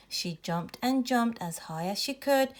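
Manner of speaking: ordinary delivery, nothing marked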